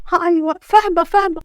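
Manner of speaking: high-pitched, flustered agreement; nervous compliance. Acted. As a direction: agree hurriedly to keep the husband calm. Cowed wife agrees to orders. (موافقة بخوف)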